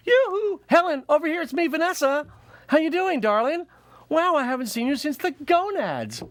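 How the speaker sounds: very high, loud sing-song greeting; calling out to a friend. Acted. Vanessa spotting her friend Helen, high sing-song call. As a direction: trill across the room to Helen. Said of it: "it's like".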